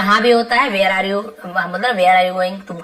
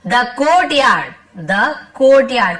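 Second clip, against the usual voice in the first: very slow, word by word, a bit louder; slow dictation; 2 semitones higher, 3 dB louder, much slower than usual. Shown as a demonstration. Teacher reading the lesson sentence to students, slow and deliberate. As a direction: read it out slowly so the class can follow.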